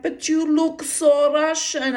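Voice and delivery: slow, high mock Russian accent, stressing so; sailors' sing-song Russian accent, drawn out. Russian sailors insist to the teenage girl, in a high Russian accent. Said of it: "they'd be like".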